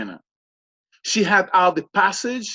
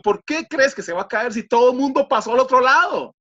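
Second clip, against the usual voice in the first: higher, incredulous retold question; baffled objection; 6 semitones higher, 4 dB louder than usual. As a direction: challenge it in acted disbelief. Same baffled question in Spanish. (pregunta incrédula imitada)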